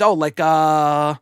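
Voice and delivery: drawn-out, stalling imitation; slow down and stall, mimicking someone unsure; imitated hesitation. Old woman at the airport puzzling over the belt, imitated hesitation. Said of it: "she started hesitating".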